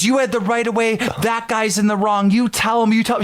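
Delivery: a hint of an excited elderly bystander; old lady cheering. The old lady backing him up in the parking lot, egging him on. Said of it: "She goes".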